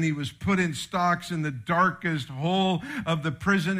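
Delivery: ordinary delivery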